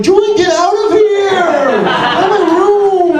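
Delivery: high, loud furious yell; angry brother yelling. Retold or imitated. Brother shouting Julian out of his room, loud high yell. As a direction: scream at him to get out. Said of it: "He's like".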